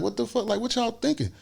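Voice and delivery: high, soft pleading voice; retold pleading. Passenger pleading with his armed abductors, high and soft. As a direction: plead high and hushed, re-enacting it. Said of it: "like, begging and pleading".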